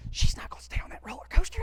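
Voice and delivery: hushed, disbelieving stage whisper; crowd whispering. Retold or imitated. Onlookers gossiping about the man and the girl, whispering. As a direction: whisper it quickly like scandalized onlookers. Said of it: "they're whispering all this".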